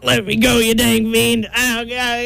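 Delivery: high-pitched, hammed-up protest; acted panic. Intruder being removed from the church, acted high panic.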